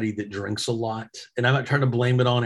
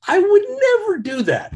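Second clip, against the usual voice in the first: emphatic denial; 6 dB louder than usual. Real, not acted. Insisting he'd never exploit the guard.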